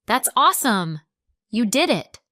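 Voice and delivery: thrilled congratulations with swooping emphasis; warm, happy excitement. Shown as a demonstration. To friend who passed driving test, happily excited. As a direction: cheer for your friend. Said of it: "It's a warm, happy phrase".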